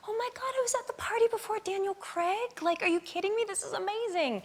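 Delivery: high-pitched, hushed starstruck rush; starstruck excitement. Retold or imitated. Guest to herself as Daniel Craig walks in, starstruck. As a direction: gush in a high, excited undertone.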